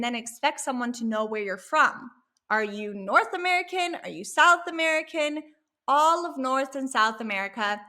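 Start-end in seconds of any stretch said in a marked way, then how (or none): none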